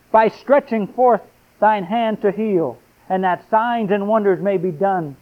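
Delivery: impassioned reading from the pulpit; fervent preaching. Acted. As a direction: stretch each word out as a plea. Scripture prayer read with fervour.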